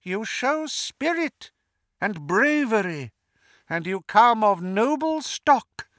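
high, measured villain, coaxing; Voldemort. A narrator's reading.

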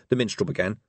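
usual speaking voice

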